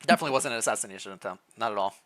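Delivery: plain reading voice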